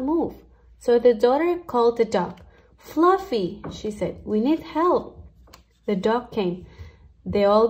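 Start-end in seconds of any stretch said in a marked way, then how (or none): 2.8-3.6 higher, sing-song call to the dog. Acted. Daughter calls the dog. 4.2-5.2 daughter asking the dog. Acted. Daughter asks dog for help.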